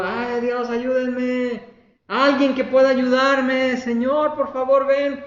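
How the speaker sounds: high, drawn-out yelling; acted cry for help. Man hanging from a branch calls to God, acted shouting. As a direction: call out desperately, pitched high. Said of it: "y gritando".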